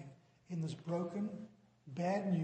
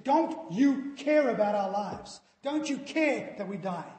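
louder, higher frightened protest; angry disciples; 4 semitones higher, 9 dB louder than usual. Retold or imitated. Preacher voicing the disciples rebuking Jesus in the storm, louder and upset. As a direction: demand it of him, upset. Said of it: "they were angry with him".

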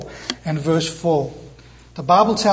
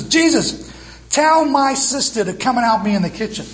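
higher, demanding call from the kitchen; bossy complaint; 6 semitones higher than usual. Retold or imitated. Martha calling to Jesus from kitchen, mildly bossy demand. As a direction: order him to fix it, a little put-upon. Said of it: "Sounds very much like, "Commander".

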